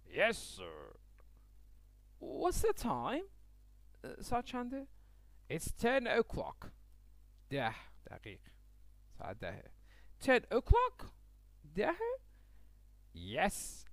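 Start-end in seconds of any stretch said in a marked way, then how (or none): 0.1-1.0 low, formal police officer answer; a deep officer's yes sir; policeman voice. Acted. Policeman politely answers Francis, low and formal. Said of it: "پلیس میگه که". 10.2-12.2 rising, loud disbelief at ten o'clock; surprised question. Acted. Francis surprised by the policeman's answer, rising and loud. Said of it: "Francis surprised by time".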